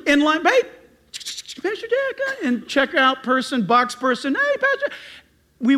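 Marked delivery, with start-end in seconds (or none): 0.4-2.4 high-pitched mock hello; hammed-up high hail. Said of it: "Christians are loud people". 4.3-4.9 very high mock hello; hammed-up high greeting. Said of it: "Christians are loud people".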